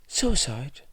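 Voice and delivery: high, startled question; shocked blurt. A narrator's reading. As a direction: blurt it in disbelief.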